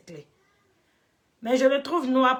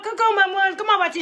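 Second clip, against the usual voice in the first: cheery greeting; 9 semitones higher, 5 dB louder than usual. Real, not acted. Greeting a viewer in chat.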